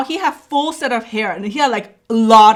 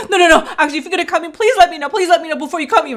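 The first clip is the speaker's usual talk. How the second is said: higher, hurried retold plea; anxious pleading; 6 semitones higher, much faster than usual. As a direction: plead urgently with the doctor. Retells begging doctor before cutting.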